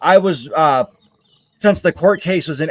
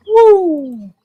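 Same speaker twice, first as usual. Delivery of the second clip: very high, loud whoop; a big cheerful goodbye whoop; excited whoop; 5 dB louder than usual. Real, not acted. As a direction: let out a joyful woo as you sign off.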